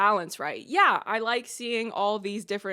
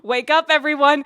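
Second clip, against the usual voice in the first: loud, raised wake-up call; 5 semitones higher, 11 dB louder than usual. Pretend. Vlogger rousing viewers over casting news, calling out loudly. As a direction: call out to rouse everyone.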